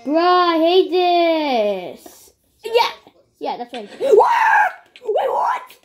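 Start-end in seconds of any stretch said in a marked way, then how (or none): 3.9-5.8 high loud startled shout; sudden surprised yelp; shocked. Real, not acted. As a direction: blurt it out in shock.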